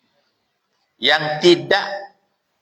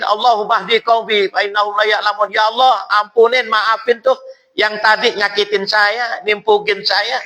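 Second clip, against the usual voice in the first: Prophet's prayer; 6 semitones higher than usual. Retold or imitated. The Prophet prays for those who stoned him, raised pleading voice. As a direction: pray for forgiveness with lifted voice. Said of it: "Didoain sama Nabi".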